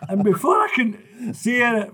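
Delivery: usual speaking voice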